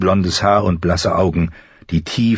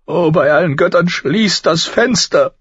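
screamed plea; 12 semitones higher, 4 dB louder than usual. A narrator's reading. Mother covering her ears against the howling wolves, screamed plea. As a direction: cry out in torment, stretching the words. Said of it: "Sie schrie".

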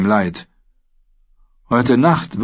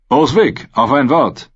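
louder call to the rider; 6 semitones higher, 5 dB louder than usual. A narrator's reading. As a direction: call him over across the horses. Jaime calling from horseback.

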